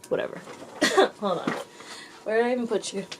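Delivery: ordinary delivery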